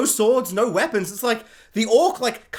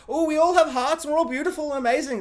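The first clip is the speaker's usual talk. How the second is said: mocking sweetness; 6 semitones higher than usual. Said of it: "character be like".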